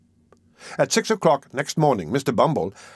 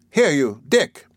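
higher, louder summons on the name; calling out; 4 semitones higher, 4 dB louder, slower than usual. Acted. Missus Mann summons Dick for the beadle, calling out. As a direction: call sharply for a boy out of sight.

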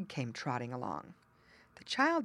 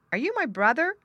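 put-on high, loud childlike question; hopeful child; 10 semitones higher, 6 dB louder than usual. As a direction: ask eagerly like a little boy. Child asks wren.

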